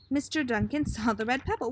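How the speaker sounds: ordinary delivery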